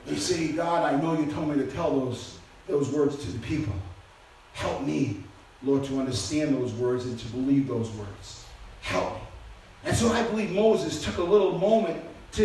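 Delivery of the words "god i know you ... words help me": much softer and lower, a near-broken plea for help; hushed pleading prayer. Acted. Moses pleading with God in private, hushed and low. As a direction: pray it quietly, as if at the end of your strength. Moses praying in tears, retold.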